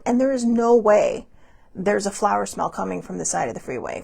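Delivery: usual speaking voice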